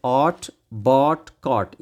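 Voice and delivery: rhyming words said one by one; read aloud clearly. Shown as a demonstration. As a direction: sound out each rhyme. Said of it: "से अलाउड, ज़ोर से बोलिए".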